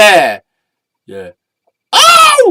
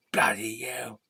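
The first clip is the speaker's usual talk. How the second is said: whispered; 8 dB quieter than usual. Acted. Puppet swears under breath.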